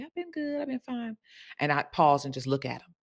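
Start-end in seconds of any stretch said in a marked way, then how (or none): 0.0-1.2 high, soft mock-breezy "I've been fine"; mimicking a client's airy "fine"; breezy clients. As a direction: brush it off in a light high voice. Clients' breezy reply, mocked.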